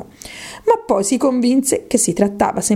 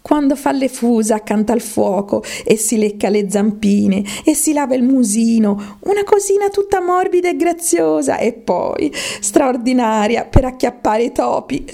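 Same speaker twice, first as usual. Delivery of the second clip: high, cooing praise of a pet; raised-pitch doting character voice; Alice, fondly musing; 5 semitones higher than usual. Acted. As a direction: gush dreamily, getting higher as you go.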